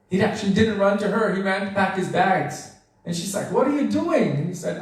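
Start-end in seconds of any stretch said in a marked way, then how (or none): 3.5-4.4 a higher, startled mother asking; surprised mother. Retold or imitated. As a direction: catch him packing and ask in alarm. Mother sees son packing bags.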